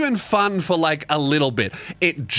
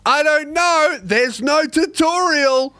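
defensive yell; 8 semitones higher, slower than usual. Retold or imitated. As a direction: yell it back, whiny and flustered. Retorting to teammates.

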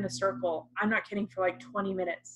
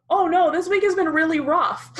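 higher and louder, hammed-up self-pity; mock whiny complaint; 9 semitones higher, 8 dB louder than usual. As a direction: play up a petty grumble about a rough week. Mocking a churchgoer's complaint.

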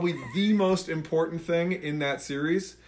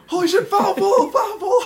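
excited yell; 7 dB louder than usual. Retold or imitated. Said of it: "and then all of a sudden I'm like".

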